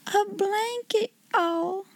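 high, slow, drawn-out "blanket hall"; astonished exclamation. A narrator's reading. Becky misrepeating Sarah's banquet hall in wonder, astonished exclamation. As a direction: draw the words out in high-pitched awe. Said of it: "ejaculated Becky".

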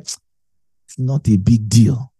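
slow, easy dismissal; slow shrug-off. Retold or imitated. Preacher voicing a listener who shrugs off a loss, slow and easy. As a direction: let it roll off, unbothered.

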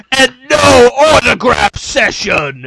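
loud and very slow, put-on villain voice with a wail in it; villain voice demand. Big player adding a last demand, slow loud wailing villain voice. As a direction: drag it out like a sore loser's final demand. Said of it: "he's like".

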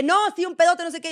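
a touch of a gossiping stranger; mock chatty stranger. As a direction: gush like a stranger in line. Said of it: "De que".

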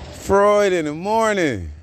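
Freud in the morning sung out high and loud; high excited call. Shown as a demonstration. Greeting a friend on the podcast, high excited call. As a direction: shout it high like a morning radio host. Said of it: "repeats the phrase".

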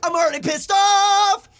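very high, loud, wobbling vibrato yell; hammed-up angry shout. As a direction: holler it almost sung, stretching "off". Repeats mock outburst, jokes about vibrato.

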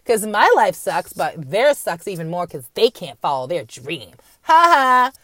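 loud mock ha ha bookending a sneer; hammed-up schadenfreude laugh; mocking gloater. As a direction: gloat like a smug TV viewer.

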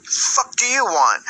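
put-on high, slow snotty girl voice; imitating a rude girl. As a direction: mimic her rude brush-off. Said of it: "She's like".

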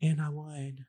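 light, soft drag voice. Real, not acted. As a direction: say it softly and demurely. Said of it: "you like the soft voice".

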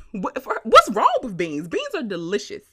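normal talking voice

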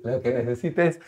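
breaks into high laughter; can't finish for laughing. Real, not acted. Bursts out laughing mid-sentence. (se le escapa la risa a mitad de frase)

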